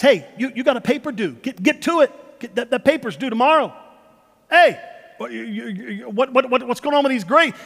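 hammed-up parent hounding a kid; nagging parent. Parent hounding a kid about a paper and grades, loud nagging.

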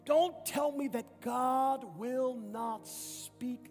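ordinary delivery

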